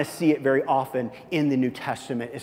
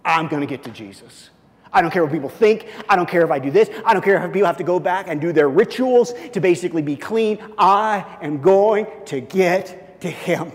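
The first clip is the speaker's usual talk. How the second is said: desperate resolve; 4 semitones higher, 7 dB louder than usual. Acted. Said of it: "an act of just total".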